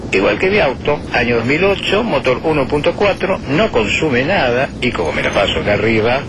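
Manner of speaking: airline captain announcement. Acted. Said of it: "les habla el capitán Bermúdez".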